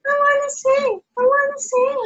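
put-on high, pleading child-puppet voice; puppet Crookie. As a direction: beg to sing like a little puppet. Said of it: "My friend Crookie is here".

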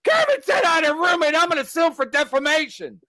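hammed-up outraged shout; mock Chad yelling. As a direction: yell it like a man who's been wronged. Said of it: "Chad's like".

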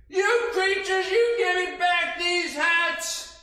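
loud, high scolding yell. Acted. Peddler yelling at the creatures to give back the hats, loud and high. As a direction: shout them down from the tree, slowly. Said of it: "maybe I'll yell at them".

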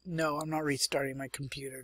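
ordinary talk, nothing marked

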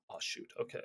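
whisper. Acted. Caught off guard on call.

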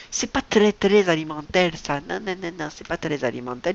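mock accent that turns every R into an L; sudden comic accent. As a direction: joke accent rolling R into L, as if imitating Boule de Suif. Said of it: "j'ai pris un accent tout d'un coup".

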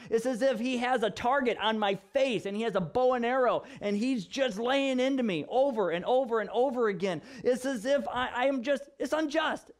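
high, emphatic acted grievance; indignant protest. Job answering his accusing friends, indignant protest. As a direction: plead your case in rising indignation.

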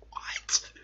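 stunned whisper; breathless whisper of disbelief. Real, not acted.

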